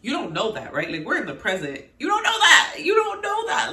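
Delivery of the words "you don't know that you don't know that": loud, high outraged repeat; exasperated shout. Real, not acted. Vlogger yelling at a show character, outraged shout. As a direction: shout it at the screen in disbelief.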